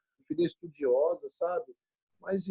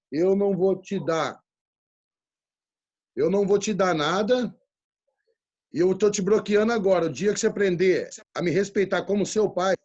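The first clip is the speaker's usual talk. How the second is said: loud, emphatic retold ultimatum; firm fatherly ultimatum; 4 dB louder than usual. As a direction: lay down the law to a daughter, raising your voice. Pai impondo limite à filha. (ultimato firme de pai, em voz alta)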